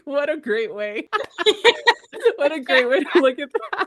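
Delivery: laughing delight. Real, not acted. Friend recalls her husband's reply, laughing. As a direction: laugh through the words, tickled by the reframe.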